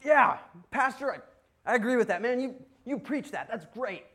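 high, gung-ho congregant cheering on the preacher; mock-enthusiastic amen to the pastor; eager agreement.

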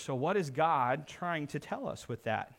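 ordinary talk, nothing marked

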